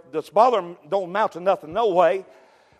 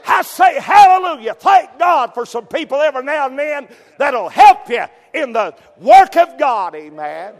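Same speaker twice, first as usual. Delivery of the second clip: much higher, much louder hallelujah shout; preaching shout; 7 semitones higher, 10 dB louder, slower than usual. Acted. Preacher shouting praise at the church, full force.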